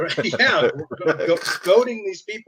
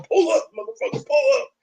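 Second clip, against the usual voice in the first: much higher, hammed-up dare; mocking tough guy; 10 semitones higher, 3 dB louder than usual. Eric in his chair taunting viewers, mocking high-pitched dare. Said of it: "he's literally in his chair going".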